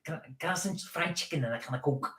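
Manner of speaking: soft imitation of Scouse; throaty-K Scouse impression; Scouse accent. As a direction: recite it in quiet Scouse. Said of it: "how to do a Scouse accent".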